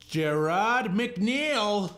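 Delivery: high, loud, drawn-out name call; winner announcement. Real, not acted. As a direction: announce the winner with long, rising excitement. Announcing the guitar giveaway winner.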